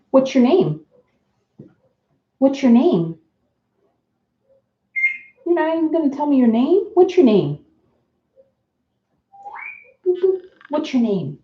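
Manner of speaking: high sing-song coaxing voice for a parrot; pet talk. Real, not acted. Talking to her parrot to get it to speak, high sing-song pet voice.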